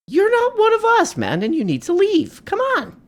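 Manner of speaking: hammed-up, very high complaint; mocking falsetto. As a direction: whine it in a silly head voice. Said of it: "Why are you saying it like that?".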